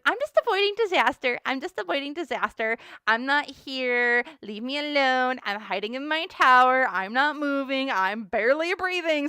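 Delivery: high, loud mock panic; panicky hideaway. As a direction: play someone frantically refusing to come out. Voices Chantal hiding from trouble.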